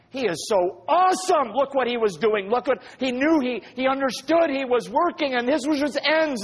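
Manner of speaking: high, loud marveling; awestruck praise. Retold or imitated. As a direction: gush with wonder at God's plan. Saints in heaven marveling.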